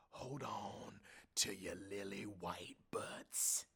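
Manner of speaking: slow, breathy whispered warning; slow whispered joke line. Retold or imitated. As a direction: hiss it slowly like a secret. Joke delivered in rehearsal.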